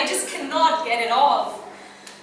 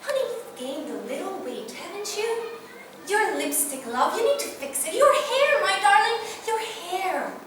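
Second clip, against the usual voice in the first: criticise with honeyed endearments, pitched high; fussy critic; 7 semitones higher than usual. Acted. People picking at Marilyn's looks, fussy and mock-sweet. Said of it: "heard people telling her".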